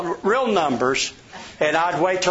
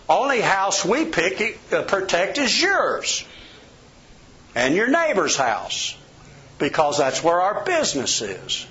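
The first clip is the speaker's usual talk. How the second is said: ordinary delivery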